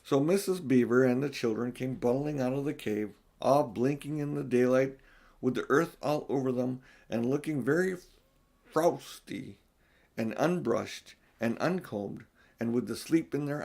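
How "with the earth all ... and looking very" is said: ordinary delivery, nothing marked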